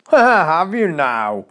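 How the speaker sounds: slow, booming mock roar of a huge blacksmith. A narrator's reading. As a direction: roll the words out slowly like a giant. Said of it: "roared the blacksmith".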